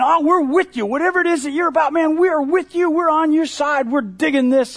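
high, loud gushing praise; excited crowd cheer. Retold or imitated. Crowd shouting praise to Jesus, excited. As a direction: cheer him on like a thrilled fan.

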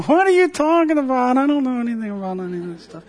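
high, quick brush-off; soft dismissive denial. Retold or imitated. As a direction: wave it away lightly as if puzzled. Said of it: "a softer sort of a denial".

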